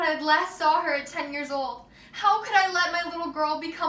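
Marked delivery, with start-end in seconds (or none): 2.1-3.9 building from a raised voice to near shouting; a mother's horror swelling as she speaks; rising anguished outcry. Acted. Mother finding her missing daughter in an ad, crying out in rising anguish.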